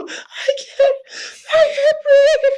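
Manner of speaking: very high, strained wheeze; choking for breath. Acted. Tackled man on the ground, choking for breath. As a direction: squeal it out as if winded. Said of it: "you hear the guy on the ground go".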